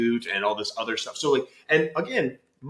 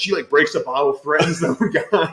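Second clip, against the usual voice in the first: ordinary talk, nothing marked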